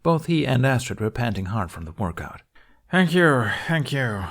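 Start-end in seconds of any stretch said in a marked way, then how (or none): 2.9-4.2 half-hearted. A narrator's reading. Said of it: "Hiccup said half-heartedly".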